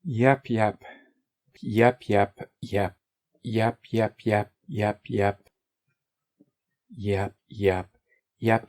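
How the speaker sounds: endless bouncy yep, yep; a silly string of yeps; mock yep chatter. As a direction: babble yep like a broken record. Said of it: "I would enjoy talking like that".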